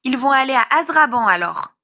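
put-on little boy stating his conclusion; young child's voice. A narrator's reading. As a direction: pipe up like a small child passing judgement. Child voiced in narrated story.

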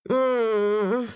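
a sulky closed-mouth hum; whiny moan. A narrator's reading. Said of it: "промычал он капризно".

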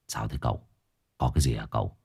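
low, hushed question to his master; concerned quiet voice; speaking quietly. A narrator's reading. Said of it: "Nó nói nhỏ".

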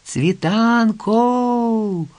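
high, long-drawn hail; stretched, searching cry; calling out. A narrator's reading. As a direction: call the missing girl from afar, holding the last vowel. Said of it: "знову гукнув Кий".

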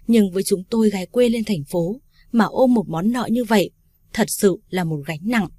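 usual speaking voice

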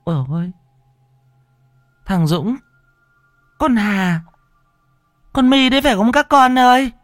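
high-pitched, distraught calling out; anxious parent. Acted. Parent calls to the children they hear crying, distraught. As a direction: call to lost children in a high voice.

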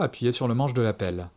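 ordinary delivery, nothing marked

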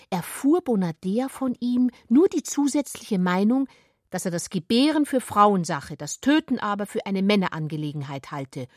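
ordinary delivery

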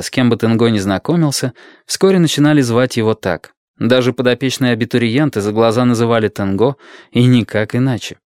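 ordinary delivery

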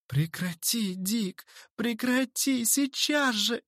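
high female-character wail, drawn out at the end; distressed wailing. A narrator's reading. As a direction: plead shrilly for the fight to stop. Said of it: "голосила Дейзи". (голосить в отчаянии)

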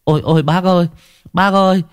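put-on breathless, panicked child; out of breath. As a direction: gasp it out after running. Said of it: "vừa chống tay thở hổn hển".

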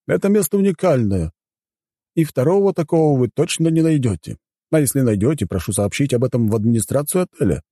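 high-pitched formal butler explaining house rules; courteous butler. Acted. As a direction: present the hotel as its dutiful butler. (учтивый дворецкий)

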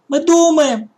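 put-on high, loud girl's shout through the hatch. A narrator's reading. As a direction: yell up at the old woman. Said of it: "крикнула Таня".

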